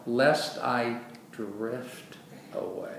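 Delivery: very slow, softening and falling; drawn-out, fading at the end; slow drifting delivery. Shown as a demonstration. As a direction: let the words drift gently away. Correct wording, voiced as gradual drifting.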